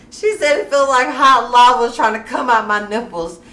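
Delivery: loud, high, laughter-fuelled retelling; amused retelling. Real, not acted. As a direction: tell it loudly, cracking up at the image. Retelling right after bursting out laughing.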